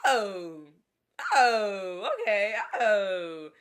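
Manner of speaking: loud, falling mock-cry "oh"; hammed-up loud groaning; exasperated wail. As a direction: wail each "oh" in exaggerated dismay. Exasperated wail at his excuse.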